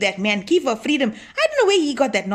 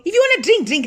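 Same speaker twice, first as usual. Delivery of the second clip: higher, louder scolding burst; a little angry; 9 semitones higher, 5 dB louder than usual. Real, not acted. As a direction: snap at the husband. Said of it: "I'll get a little bit angry".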